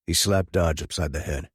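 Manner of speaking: usual speaking voice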